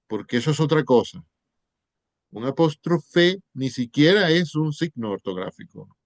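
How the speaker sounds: ordinary talk, nothing marked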